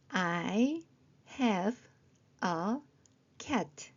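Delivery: very slow, a pause after each word; word-by-word reading. Shown as a demonstration. Teacher checking phonics with short sentences, word by word. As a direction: sound out each word separately.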